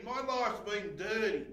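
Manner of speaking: ordinary talk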